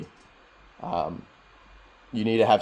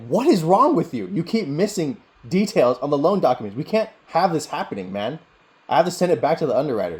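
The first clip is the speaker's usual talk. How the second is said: higher, louder exasperated scolding, imitating a boss; mock-chew out an employee in a raised, high-pitched voice; angry boss; 8 semitones higher, 6 dB louder than usual. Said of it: "my boss was like".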